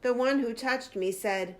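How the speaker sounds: ordinary delivery, nothing marked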